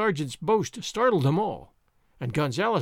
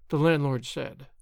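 ordinary delivery

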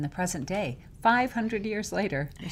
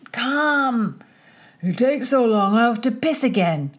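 higher, louder boisterous drinking crowd voice; half a dozen sloppy men hurrying the earl, rowdy and louder; rowdy drunk men; 5 semitones higher, 7 dB louder than usual. Acted. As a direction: bellow it like an impatient drunk.

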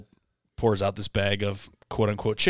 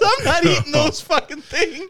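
very high, loud hammed-up refusal; mock furious aunt; 5 dB louder than usual. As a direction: shriek it as the outraged aunt. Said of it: "She goes".

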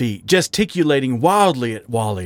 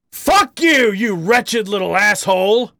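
furious high bellow, much louder; 10 semitones higher, 4 dB louder than usual. Acted. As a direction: roar the insult at him. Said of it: "Harry bellowed".

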